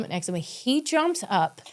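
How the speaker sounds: ordinary talk, nothing marked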